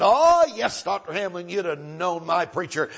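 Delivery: bursts out high and loud, then settles into a proud grin; beaming delight. Retold or imitated. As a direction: answer like a beaming old man thrilled to be asked. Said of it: "he smiled like a jack-o'-lantern".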